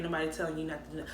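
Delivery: normal talking voice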